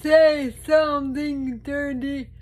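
giggling through the words; laughing loudly while talking. Real, not acted. Laughing at her own riddle.

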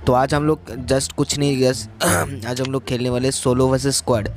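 ordinary talk, nothing marked

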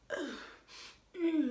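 ordinary talk

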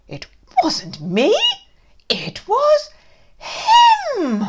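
rising to a high shouted him; indignant blame. Acted. Teacher blaming dad, rising to a loud shriek. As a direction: build to an accusing shriek.

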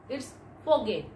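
ordinary talk

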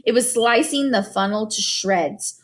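plain reading voice

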